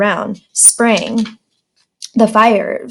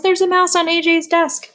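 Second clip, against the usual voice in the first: put-on high squealing girls, much higher; screamed; 9 semitones higher, 3 dB quieter than usual. A narrator's reading. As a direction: girls point out the mouse, screaming. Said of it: "screamed all the girls".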